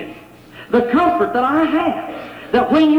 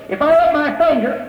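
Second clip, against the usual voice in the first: ordinary delivery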